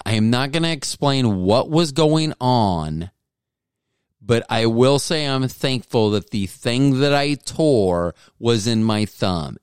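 usual speaking voice

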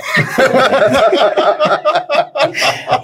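long high burst of laughter; hearty laugh. Real, not acted. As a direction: crack up at your own story. Laughing at own story. (gargalhada alta e longa)

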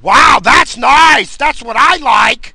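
high, drawn-out mocking shout; loud leering coworker. Loud guy ogling a passerby at work, mocking shout. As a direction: bellow it slowly, far too loud for an office. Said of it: "This guy's all loud".

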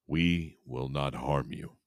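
low, slow alien groan. A narrator's reading. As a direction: groan it slowly, low in pitch. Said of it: "a voice groaned in my head".

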